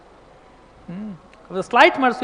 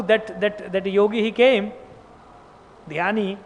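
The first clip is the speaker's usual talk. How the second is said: ordinary delivery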